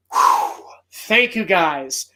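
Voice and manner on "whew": ordinary delivery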